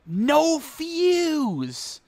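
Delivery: high, drawn-out cry; lamenting the missing fuse; dismayed exclamation. Real, not acted.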